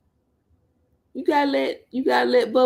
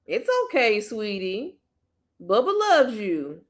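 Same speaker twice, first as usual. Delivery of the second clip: tender comfort. Real, not acted. Comforting crying child.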